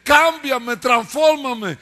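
Malavé's crying prayer. Retold or imitated. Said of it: "él llora y llora y él diciendo".

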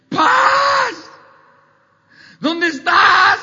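high, very loud cry for peace; desperate shout. Acted. Preacher acting out someone begging for peace, desperate shout. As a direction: scream it out, pleading at full volume. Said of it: "pero pides a gritos".

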